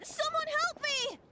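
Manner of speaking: high-pitched damsel-in-distress plea; hammed-up helpless victim crying out; feigned cry for help. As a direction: put on a high, panicked call for rescue. Feigned cry for help, later drops the act.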